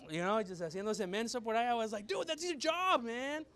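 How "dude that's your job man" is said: higher, louder incredulous complaint; exasperated protest. Retold or imitated. Churchgoer to an idle security guard, exasperated. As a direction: protest in disbelief, pitched up.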